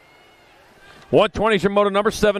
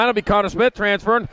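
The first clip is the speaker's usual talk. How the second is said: normal talking voice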